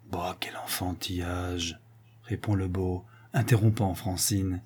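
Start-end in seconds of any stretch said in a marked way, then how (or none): 0.1-1.8 dismissive whisper, slowed down; whispered scoff. Acted. The suitor whispers to Francine, scoffing at her fears. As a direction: brush off her fear in a breath. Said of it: "pour dire tout bas".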